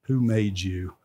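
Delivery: low, very slow, restrained anger; frustrated patience, drawn out low and slow; a touch of stern, measured anger; a little angry. Retold or imitated. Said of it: "He was getting a little angry".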